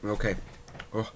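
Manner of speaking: ordinary delivery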